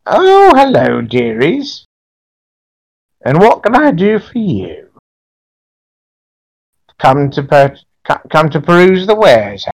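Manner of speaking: high, creaky witch crone; very old woman. Acted. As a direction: cackle it out like a market hag.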